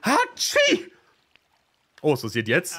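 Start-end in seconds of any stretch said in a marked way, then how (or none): 0.0-0.9 a big theatrical sneeze; sneezing. Acted. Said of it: "in deine Schulter niesen".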